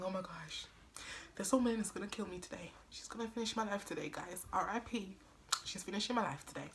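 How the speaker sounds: soft, fast, scared muttering to herself; panicked inner whisper. Retold or imitated. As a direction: rush it under your breath like you're doomed.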